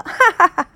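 acted laugh. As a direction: burst out laughing at the gossip. Gossip ends in laughter.

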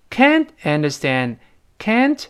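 ordinary talk, nothing marked

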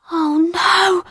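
high, drawn-out hushed dread; frightened whisper. A narrator's reading. Becky hearing feet on the stairs, frightened whisper. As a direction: breathe it out high in alarm. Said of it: "whispered Becky".

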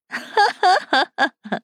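high staccato evil laugh; gloating cackle. Acted. Captor laughs at the cornered captive, high gloating cackle. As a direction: cackle in crazed triumph.

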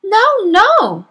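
high, loud desperate protest; crying out. Acted. Teacher cries out in denial to the headmistress, high and loud. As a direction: cry out to deny it. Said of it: "cried Miss Honey".

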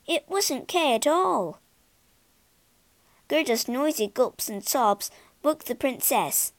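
usual speaking voice

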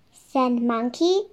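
usual speaking voice

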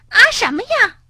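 irritated mole whose mound was flattened, snapping; cross mole. Acted. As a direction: snap at him like an annoyed small creature. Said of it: "小鼹鼠生气地说".

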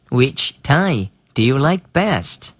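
slow, careful read of the question; slow classroom model read; slow teaching read. Shown as a demonstration. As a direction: spell it out slowly for learners. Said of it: "我用正常的语速来为大家朗读一遍".

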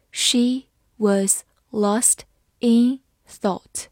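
very slow, word by word; slow reading. Shown as a demonstration. Teacher reading slowly for students, slow and clear. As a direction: read it slowly for learners. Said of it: "好，慢慢来读。".

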